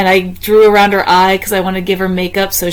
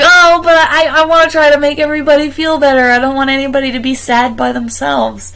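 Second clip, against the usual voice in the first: high, loud, eager protest; earnest eager protest; 7 semitones higher, 3 dB louder than usual. Retold or imitated. To the leader who praised her, an eager high protest. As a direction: insist you only want to help everyone.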